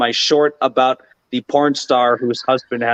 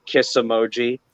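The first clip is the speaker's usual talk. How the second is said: ordinary talk, nothing marked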